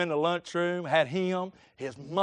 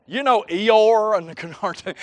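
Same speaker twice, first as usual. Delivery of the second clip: ordinary delivery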